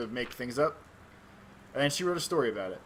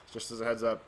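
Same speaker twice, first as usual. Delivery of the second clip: ordinary delivery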